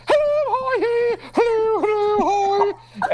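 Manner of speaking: very high, loud, sing-song vlogger hello; squealed high-pitched greetings; chipper YouTuber greeting. Retold or imitated. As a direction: gush hello like an over-eager vlogger. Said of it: "I'd hear".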